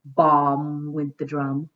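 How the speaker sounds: slow loud bass-drum boom. Pretend. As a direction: boom it out big. Onomatopoeia heaven, drum again.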